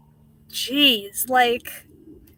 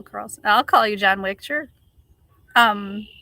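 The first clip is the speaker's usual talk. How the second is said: ordinary talk, nothing marked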